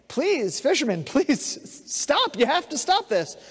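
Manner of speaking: high, urgent pleading; acted plea of panicking passengers; begging. A narrator's reading. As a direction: beg him to stop, pitch jumping up. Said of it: "the passengers kept begging".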